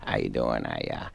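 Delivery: low, soft mumble easing up to normal pitch; lightly mumbled comic impression; Adam Sandler. Comic shows Sandler's first phase, mumbling. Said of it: "where he just kinda mumbles".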